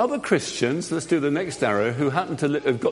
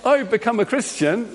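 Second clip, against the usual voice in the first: a bright, raised announcement to the doubters; eager convert; 8 semitones higher than usual. Acted. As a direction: burst in proudly announcing your conversion. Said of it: "go along to a whole bunch of Christians and say".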